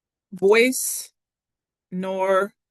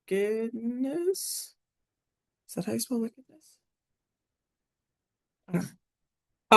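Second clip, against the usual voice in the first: puzzled muttering; 3 semitones higher, 4 dB quieter than usual. Real, not acted. Doubting own spelling.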